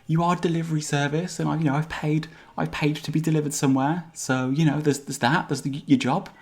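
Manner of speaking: high-pitched, hesitant little hamster; small creature arguing its case, pitched well up; bargaining tiny hamster. Acted. Plays the hamster arguing back.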